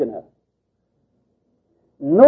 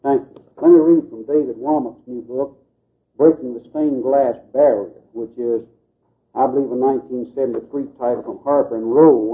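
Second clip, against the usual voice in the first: ordinary delivery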